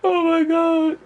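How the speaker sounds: high, squeaky, tearful laughter voice; real helpless amusement; worn out from laughing. Real, not acted. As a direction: sigh it high after a laughing fit. Recovering from another laughing fit.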